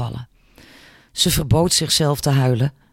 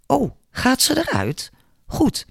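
mock surprise, pitched much higher; sweetly sarcastic, setting him up; 10 semitones higher than usual. A narrator's reading. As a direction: coworker needling the boss with false surprise. Janet turns sarcastic on Palmer.

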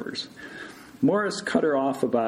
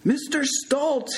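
slow, high shout; louder shout; 14 semitones higher, slower than usual. A narrator's reading. Morris to patient under thick bedsheet, shouting harder. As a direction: holler the name slowly for someone hard of hearing. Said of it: "he shouted even louder".

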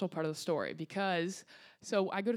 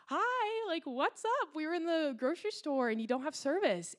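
much higher, breezy clueless mom voice; carefree mom; 8 semitones higher than usual. Retold or imitated. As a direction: chirp it like nothing is wrong. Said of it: "my mom who's like".

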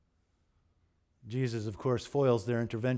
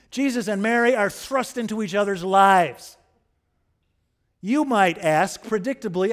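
higher, louder pulpit preaching, pressing each point; preaching at full force; 5 semitones higher, 6 dB louder than usual. Acted. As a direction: drive the sermon home to the congregation.

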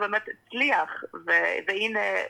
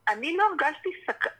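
ordinary talk

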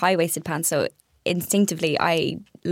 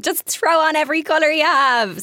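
higher-pitched, playful burst of self-quotation; gleeful abandon; 8 semitones higher, 3 dB louder than usual. Retold or imitated. Stylist quoting her own go-all-out impulse, gleeful abandon. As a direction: toss it out with carefree enthusiasm.

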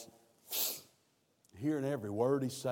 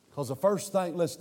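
normal talking voice

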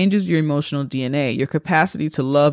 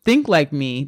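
normal talking voice

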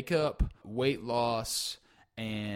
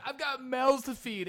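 mock pleading; 13 semitones higher than usual. Host pleading.

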